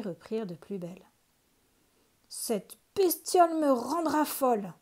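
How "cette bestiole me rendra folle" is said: higher, louder exasperated curse; fed up old witch snapping; cursing witch. A narrator's reading. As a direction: witch furious at the crying baby. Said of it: "pesta la vieille".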